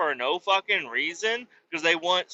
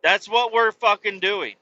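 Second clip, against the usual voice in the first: normal talking voice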